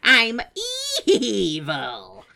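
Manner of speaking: loud hammed-up villain line; cartoon villain. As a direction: declare it like a cartoon baddie. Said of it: "swoops in to be like".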